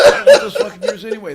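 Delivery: loud, fast laughing rant; laughing outburst. Real, not acted. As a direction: blurt it out laughing.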